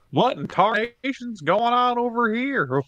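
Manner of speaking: put-on high, slow old-timer drawl; cowboy voice. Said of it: "That's my cowboy".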